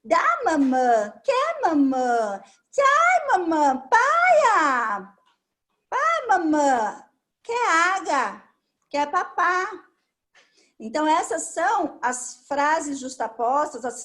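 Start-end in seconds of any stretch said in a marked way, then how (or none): 0.0-9.7 put-on slow toddler two-word phrases, with cries; toddler talk. Shown as a demonstration. Therapist demonstrating toddler speech to a parent, slow childish voice. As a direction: imitate a little child asking mom for things. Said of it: "justa posição de vocábulos".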